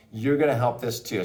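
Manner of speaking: ordinary talk, nothing marked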